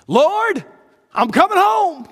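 high, loud joyful calling out; rejoicing cry. Acted. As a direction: cry it out as if you see heaven opening. Dying believers rejoicing at the end.

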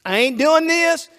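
loud, high, defiant donkey voice; the donkey flat out refusing its master, loud and stubborn. Retold or imitated. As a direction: dig in your heels and refuse as the donkey. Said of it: "and the donkey says".

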